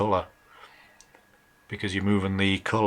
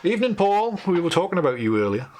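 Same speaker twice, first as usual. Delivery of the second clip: cheerful greeting; 9 semitones higher, 6 dB louder than usual. Real, not acted. Greeting a viewer on livestream.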